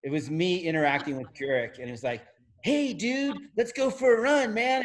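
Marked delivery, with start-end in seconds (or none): 2.6-4.8 laid-back surfer dude, pitched higher; California surfer voice. Retold or imitated. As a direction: do a higher, easygoing surfer bro. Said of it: "like this California surfer voice guy".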